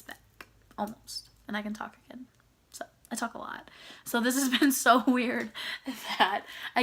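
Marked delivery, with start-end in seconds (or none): 0.0-3.7 whispery. Real, not acted. Voice trailing into a whisper.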